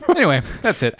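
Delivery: normal talking voice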